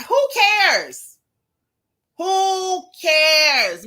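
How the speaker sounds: high, loud who cares, thrown up in the air; exasperated who cares. Real, not acted. Answering a viewer's comment with a loud fed-up who cares. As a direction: shout it out, fed up.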